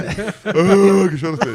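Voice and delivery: loud mocking family jeer with a laugh; mock the ugly crying like the whole family does, laughing. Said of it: "Todo mundo fica assim".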